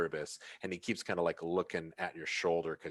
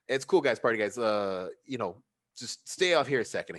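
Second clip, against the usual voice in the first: placating bouncer; 4 semitones higher, 5 dB louder than usual. Acted. Bouncer calms the party.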